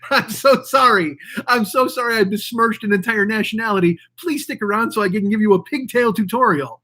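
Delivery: sarcastic whimpering sorry; mock sobbing apology. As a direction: fake tears for the subscribers. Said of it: "they're like".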